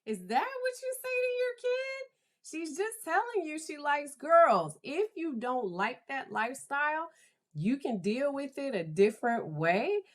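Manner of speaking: very high, soft disbelief; high-pitched pleading protest, easing down gradually; incredulous reaction. Retold or imitated. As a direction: object in a squeaky, aghast tone. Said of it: "I was like".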